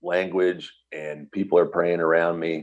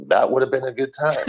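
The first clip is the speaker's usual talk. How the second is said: laughing; 9 semitones higher, faster than usual. Real, not acted. Joking reply then laughing.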